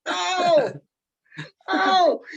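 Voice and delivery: high, loud horrified cry of no; mock horrified no. Reader recoiling at the zombie fight, crying no in horror. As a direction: cry out no at the page in shock. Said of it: "I was like".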